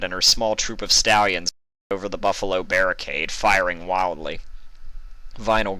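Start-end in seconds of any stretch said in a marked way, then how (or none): none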